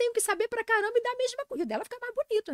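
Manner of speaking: high-pitched, loud comic outrage; indignant complaint. Real, not acted. Nutritionist griping about teleprompter-reading rivals, indignant.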